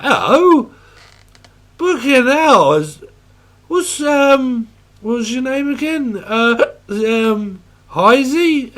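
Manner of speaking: mock drunk. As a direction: play a confused pub drunk. Acting a drunk person.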